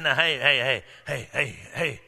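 low, soft shushing rebuke; hushing crowd. Retold or imitated. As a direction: hush the beggar down quietly. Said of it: "they said".

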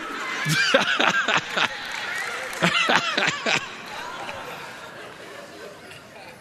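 breathy high-pitched laughter. Real, not acted. Preacher laughing after imitating his mama. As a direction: burst into an airy laugh.

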